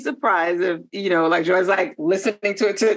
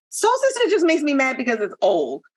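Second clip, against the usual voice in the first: ordinary talk, nothing marked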